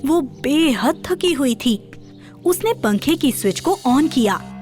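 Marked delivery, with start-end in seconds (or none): none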